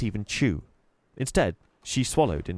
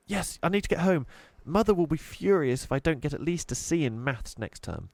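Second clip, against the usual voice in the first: flustered hurry; 8 semitones higher than usual. Acted. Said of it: "she was beginning to panic".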